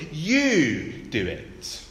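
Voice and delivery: You said with weight, slow and deliberate; emphatic you. Shown as a demonstration. Preacher showing Jesus' emphatic You, slow with You stressed. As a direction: lean hard on You, drawn out slowly. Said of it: "The you is emphatic".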